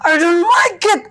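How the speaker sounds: high, loud, tearful protest; child's angry near-crying outburst. Retold or imitated. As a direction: cry it out like an upset five-year-old. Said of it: "marah, terus dia kayak".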